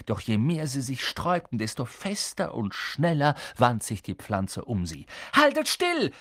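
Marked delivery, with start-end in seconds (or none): 5.4-6.1 sharp high loud order; girl ordering the boys to keep still; commanding. Acted. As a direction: order them to freeze. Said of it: "befahl ihnen Hermine".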